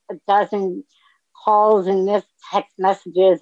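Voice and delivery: ordinary delivery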